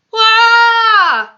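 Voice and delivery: high, full-voiced wail of a roar; loud roar. A narrator's reading. Sleeping giant in a nightmare, loud high roar. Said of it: "roared the flesh lump eater".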